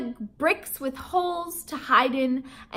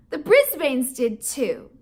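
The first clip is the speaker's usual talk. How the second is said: ordinary delivery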